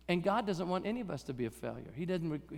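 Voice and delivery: usual speaking voice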